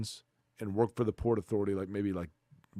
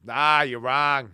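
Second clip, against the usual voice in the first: Boston caller; 4 semitones higher, 7 dB louder, much slower than usual. Retold or imitated. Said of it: "He says".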